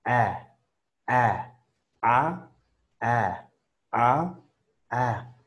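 sustained model vowels A and E; vowel drills. Shown as a demonstration. Teacher models vowel sounds for students. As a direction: drill each vowel clearly for the class. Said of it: "El tercero va a ser la E torpe".

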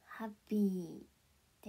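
ordinary talk

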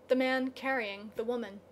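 ordinary delivery

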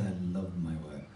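low, soft quoting; quiet sincere quote. Retold or imitated. Speaker quoting Steve Jobs's love of work, hushed and sincere.

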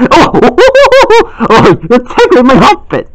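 giggly tickle protest; tickled laughing. Acted. Being tickled.

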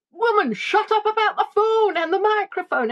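imitating a fed-up husband, pitched high; a mock exasperated snap at his wife; grumpy husband. Teasing wife voices her husband telling her to shut up.

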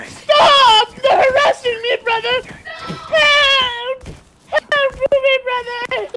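wailing, shouted mock distress; hammed-up cry for help. Character begs brother to stop the harassment, hammed-up wail. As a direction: call out as a put-on victim.

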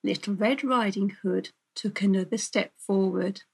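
ordinary delivery, nothing marked

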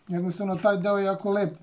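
normal talking voice

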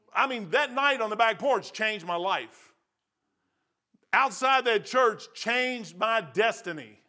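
loud, high revival preaching; full-force preaching. Acted. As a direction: preach it hard to the congregation.

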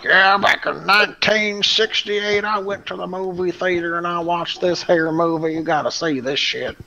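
hillbilly voice. Retold or imitated. Son imitating dad recommending an old movie, hillbilly voice. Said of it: "gave him a hillbilly voice".

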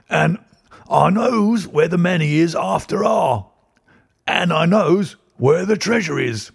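put-on high, slow cockney old-timer; crowing old boatman who knows a secret; old man voice. A narrator's reading. Old man voiced, dialect speech.

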